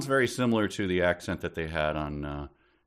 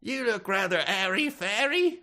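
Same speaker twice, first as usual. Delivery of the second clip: mock posh tourist; 3 dB louder than usual.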